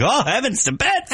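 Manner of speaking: mock surprise. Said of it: "You're kinda like".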